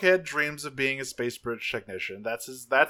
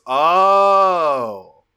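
long, loud, higher oh of false realization; drawn-out mock oh; 4 semitones higher, 10 dB louder than usual. Longarm answering Bumblebee about Wasp, a loud drawn-out mock oh. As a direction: stretch the oh like you just get it. Longarm reacting to spy accusation.

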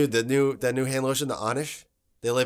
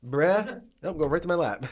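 ordinary talk, nothing marked

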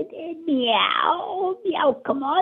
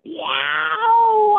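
excited meow; 7 semitones higher than usual. Pretend. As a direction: yowl with greed for the fish. Cat excited about food.

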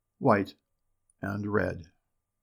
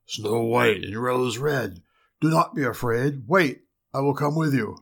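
bear calling. A narrator's reading. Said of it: "but the bear called to them".

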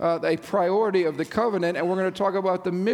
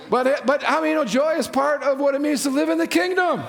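fervent preaching; 7 semitones higher than usual. Acted. Pastor fervently urging the congregation toward joy. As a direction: preach it out with full conviction.

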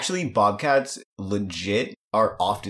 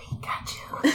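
faint, breathy far-off cry; like it's halls away; distant muffled whisper; 5 dB quieter than usual. Acted. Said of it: "slightly more muffled".

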